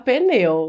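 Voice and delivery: "pneu" as pe-NEU, e after the P. Retold or imitated. Teacher quoting her colleague's pneu with an E. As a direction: say "pneu" with an e slipped in. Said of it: "Pedro diz que gosta de pronunciar".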